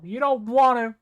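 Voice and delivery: loud call down a long hall; yelling. Acted. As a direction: shout it from far off. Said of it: "I just yell from the other end of the hall".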